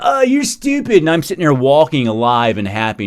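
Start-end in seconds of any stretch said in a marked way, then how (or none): 0.0-1.0 much higher caricature sneer; mock them in a high prissy voice; mocking critic. Imitating critics calling him stupid, high mock sneer. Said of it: "you're telling".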